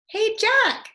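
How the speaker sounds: very high, loud cheerful call. Acted. Annie calls Jack from the top of the hill, very high cheerful call. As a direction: call down from the hilltop. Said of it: "Annie called".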